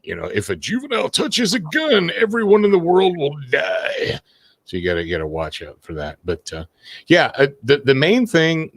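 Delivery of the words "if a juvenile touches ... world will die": high, breathless panic-monger; shrill mock doom; mock alarmist voice. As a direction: ham up the hysterical nanny-state fear. Mocking states' hysteria.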